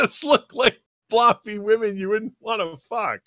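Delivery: high-pitched protest breaking into laughter; squeaky, laughing indignation; exasperated rant. Pretend. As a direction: rant in mock disbelief through giggles. Player continues mock rant, laughing.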